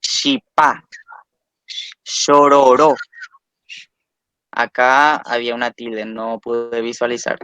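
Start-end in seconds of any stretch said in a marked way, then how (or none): 0.0-0.8 "Shipa" with the final A stressed; stress on final vowel. Shown as a demonstration. Teacher showing tonic accent, last A stressed in "Shipa". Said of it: "la última vocal se pronuncia con mayor fuerza". 2.0-3.0 "Shororó" with the final Ó given most force; stress on final vowel. Shown as a demonstration. Teacher showing tonic accent, last Ó stressed in "Shororó". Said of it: "la última vocal se pronuncia con mayor fuerza".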